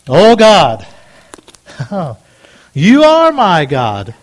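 loud preacher's psalm reading; preaching scripture. Acted. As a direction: proclaim the verse to the congregation. Reads psalm with full force.

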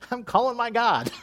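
giggling through the words; laughing reply. Pretend. Preacher answering a bystander, laughing.

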